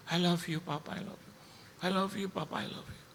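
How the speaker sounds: high, soft plea that drops away; imitated tearful child. Child hugging Papa after the crash, imitated high plea fading soft. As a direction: say it high, then let it fade very quiet. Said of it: "dia peluk".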